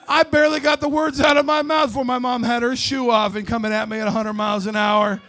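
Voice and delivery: ordinary delivery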